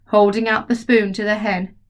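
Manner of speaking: ordinary delivery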